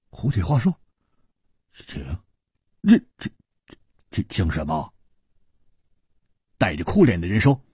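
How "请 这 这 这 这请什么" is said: stammering disbelief. Acted. Hu stammers at the offer.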